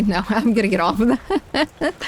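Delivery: ordinary talk, nothing marked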